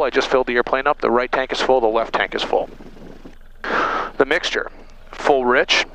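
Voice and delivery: ordinary talk, nothing marked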